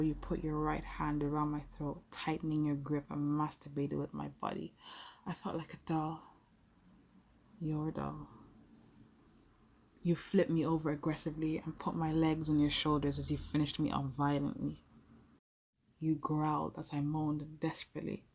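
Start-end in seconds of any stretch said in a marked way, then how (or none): none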